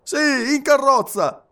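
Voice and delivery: high, loud repeat; echoed boarding call. A narrator's reading. Passepartout repeating the conductor's call, high and emphatic. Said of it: "ripeteva Passepartout".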